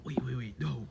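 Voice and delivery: a hushed whisper, stunned; whispered disbelief. Retold or imitated. Bakugo realizes Deku had a quirk all along, whispered. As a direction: breathe it out unvoiced in shock.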